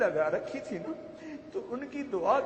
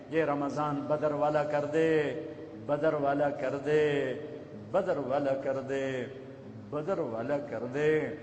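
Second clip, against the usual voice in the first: lower, slow, earnest imploring; slow pleading refrain; 6 semitones lower, slower than usual. Real, not acted. Preacher begging God to make Ramadan like Badr, slow earnest plea. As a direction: draw out each plea to God.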